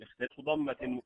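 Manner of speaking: ordinary talk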